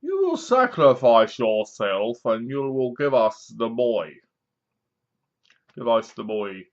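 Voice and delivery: slow menacing Bane growl, low and measured; Bane impression. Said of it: "what I could imagine Tom Hardy go, as Bane going".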